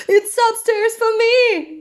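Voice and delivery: high storybook holler; excited yell. A narrator's reading. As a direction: call it out high, stretching the end. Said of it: "yelled Ted".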